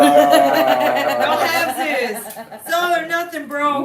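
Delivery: loud, drawn-out words through laughter; cracking up mid-sentence; laughing. Real, not acted. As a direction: laugh it out while declaring it. Laughing at friend's remark.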